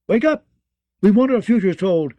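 shouting, high and loud; loud demand. A narrator's reading. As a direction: yell her awake, imperious. Girl kicks the witch's bed.

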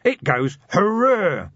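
loud, high, slow triumphant shout; wild shout. Acted. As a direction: cheer it out as the flames burst. Vimes shouting as weapon explodes.

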